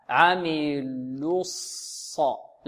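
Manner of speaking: very slow عملوا الص, rounded "luu" gliding into emphatic s; drawn-out "aamilus-s", lips rounded only on the lam; rounded lam into sad. Shown as a demonstration. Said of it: "فننتقل من اللام المضمومة".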